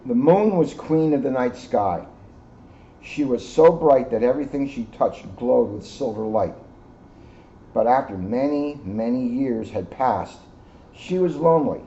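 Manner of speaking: put-on reading a picture book to young children, lively and bright; storybook narration. A narrator's reading. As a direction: tell it like a bedtime story for kids.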